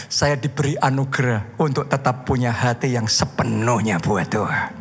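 low, solemn wish; humble, deep-pitched petition; earnest prayer. Real, not acted. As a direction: sink gradually into a low, heartfelt murmur. Earnest personal prayer for grace.

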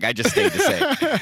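high laughing mimicry of a friend; friend's excuse mocked in a high, laughing voice; laughing mock quote. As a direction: squeak it out through a laugh.

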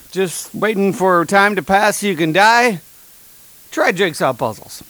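a touch of a radio ad pitch; mock sponsor announcement. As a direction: lightly announce the sponsor like a cheesy commercial.

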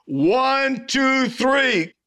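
high, eager count to three; excited countdown. Real, not acted. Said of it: "All right".

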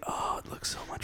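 breathy hushed aside; whisper. Retold or imitated. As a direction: whisper it in awe. Whispered aside about toy displays.

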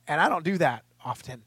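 ordinary talk

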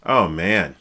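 ordinary delivery

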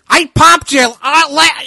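high, loud, slowed Pesci gangster voice; Joe Pesci impression. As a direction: snarl it like Pesci in Casino. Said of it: "I had to get my Pesci voice going on".

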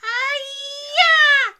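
high, loud shrieking karate chop; Miss Piggy karate cry. Shown as a demonstration. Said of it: "Can you do this? Go".

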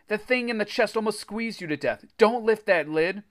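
high, alarmed warning; shrieked warning. A narrator's reading. Narrator warns Greg about the chest, shrieked warning. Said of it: "I shrieked".

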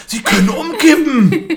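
high, loud, drawn-out mock warning; hammed-up shouted alarm; alarmed nurse. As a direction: cry it out like a horrified nurse. Nurse warns patient in retold scene.

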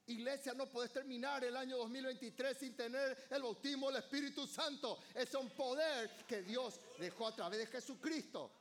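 ordinary delivery

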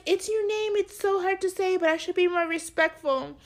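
high mock voice of the coworker; hammed-up imitation of the coworker. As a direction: parrot her in a high voice. Mimicking coworker's excuse.

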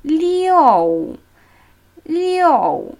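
"Liu" glided high and falling, weight on its O; falling tone, stressed O. Shown as a demonstration. Teacher blending the vowels of six, falling tone. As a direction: stretch "Liu" from a high start and let it fall. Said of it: "Betonung auf O".

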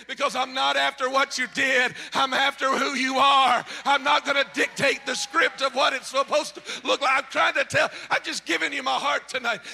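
loud, driving pulpit delivery; fiery preaching. Acted. Pastor rousing the congregation, full-force preaching. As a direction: preach it to the rafters.

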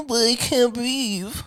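high, drawn-out mock whining; hammed-up wail, stretching out each word; exaggerated high-pitched crybaby complaint; whiny mask complainer.

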